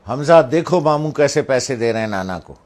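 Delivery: loud, high commanding voice; stern mother imitation. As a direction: re-enact mother's loud order and call. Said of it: "دوبارہ جب امی نے کہا".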